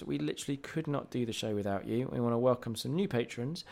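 normal talking voice

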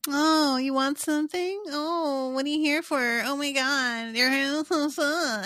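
high teasing sing-song; mocking sprites. Sprites circling Tarisai and mocking her in a high voice. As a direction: needle her like buzzing pests. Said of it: "They're just, like, mocking her".

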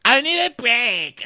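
put-on very high, slow babyish whine; mocking Colton as a crybaby; whiny baby voice. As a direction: whimper it like a little baby. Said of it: "He's like".

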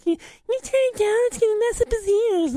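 very high falsetto mock scolding; hammed-up high-pitched fretting mother; nagging wife impression. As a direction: scold in a squeaky put-on wife voice. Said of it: "Tammy gets home 'cause she's like".

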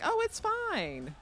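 high, slow put-on sing-song voice; the freaks forgiving him, high and slow in mock breeziness; mock breezy. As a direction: drawl it out lightly, as if nothing happened. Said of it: "And then everyone likes".

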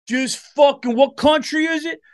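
loud, high, wild warmonger voice; trigger-happy guy. Acted. Reckless guy yelling to blow a country up, loud and high. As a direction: yell it like a reckless guy eager to bomb. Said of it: "you don't want a guy like".

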